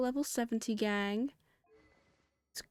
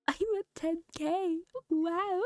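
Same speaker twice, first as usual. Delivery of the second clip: delighted, laughing, higher; bursting with happy disbelief; excited; 8 semitones higher than usual. Real, not acted. Thrilled at reaching ten K.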